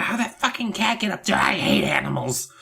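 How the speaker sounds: mock crotchety old neighbor voice; grumble, starting high and quick; hammed-up cantankerous complaint; cranky neighbor.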